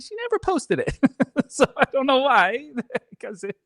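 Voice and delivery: laughing. Real, not acted. Laughing retelling.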